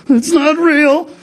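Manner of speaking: high, slow, frightened "It's not real"; a little boy insisting, stretched out; imitating a scared child; scared kid. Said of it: "That's like a kid's response".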